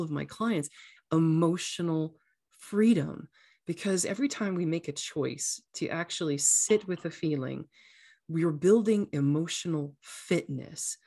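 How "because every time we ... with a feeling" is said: ordinary talk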